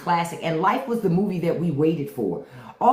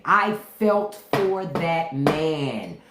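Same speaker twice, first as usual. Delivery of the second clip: normal talking voice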